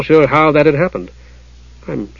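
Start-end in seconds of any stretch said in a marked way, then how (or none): 1.9-2.1 soft, feeble apology; lame apology. A narrator's reading. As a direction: apologize lamely, voice dropped. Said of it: "he said lamely".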